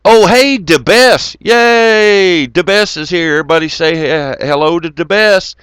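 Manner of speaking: loud high cheer; excited greeting. Real, not acted. Streamer welcoming DeBess to the chat, cheering loudly. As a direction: whoop a welcome to a friend.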